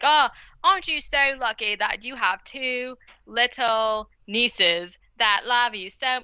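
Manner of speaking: slow, struggling mock British aunt; botched British accent. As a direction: stumble grandly through fake British. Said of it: "didn't know how to say all of the words in British".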